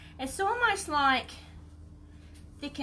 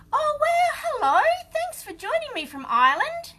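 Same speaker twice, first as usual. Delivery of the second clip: much higher, louder excited welcome; thrilled, loud high-pitched hello; delighted greeting; 8 dB louder than usual. Real, not acted. Streamer welcomes a viewer joining from Ireland, delighted.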